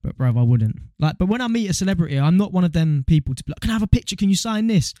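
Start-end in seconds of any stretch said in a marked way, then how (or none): 3.6-4.9 eager fan voice. Retold or imitated.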